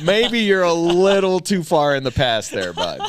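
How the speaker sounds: laughing through a jab at Texas; high, loud, half-laughing mockery. Real, not acted. Comic ribbing Texas for lagging behind Oklahoma, laughing.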